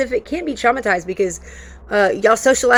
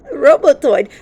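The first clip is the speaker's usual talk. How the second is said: robotoid said higher and louder; emphatic robotoid; 5 semitones higher, 8 dB louder than usual. Shown as a demonstration. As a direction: robotoid lifted high and pushed out loud. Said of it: "give that damn robot a turn".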